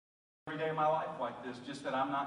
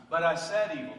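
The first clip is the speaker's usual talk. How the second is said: louder, pressing the word said; louder confession; 2 semitones higher, 7 dB louder than usual. Acted. Preacher confessing his sin before God, louder. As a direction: confess it out loud. Contrast with God's blessing.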